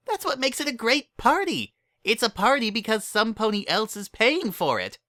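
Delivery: squeaky cheerful pony; high excited Pinkie voice; Pinkie's voice. A narrator's reading.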